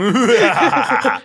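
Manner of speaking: high cackling witch laugh. Acted. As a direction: cackle like an old hag. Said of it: "ahí está la risa de bruja".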